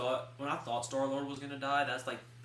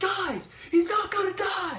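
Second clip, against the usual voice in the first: much higher, louder hammed-up protest; mock shout back; 12 dB louder, slower than usual. Defending the movie to her yelling family, high mock shout. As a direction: yell it back over the crowd. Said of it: "I'm sitting there like".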